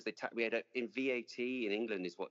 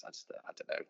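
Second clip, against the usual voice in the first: normal talking voice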